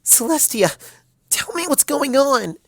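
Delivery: panicked. A narrator's reading. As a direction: panic rising, demand an answer. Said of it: "Twilight started to panic".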